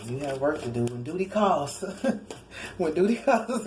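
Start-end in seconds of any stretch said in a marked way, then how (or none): 1.9-2.7 breathy laugh. Real, not acted. Laughs at own joke. 2.8-3.7 words broken by a laugh; laughing mid-phrase. Real, not acted. Laughs at own joke.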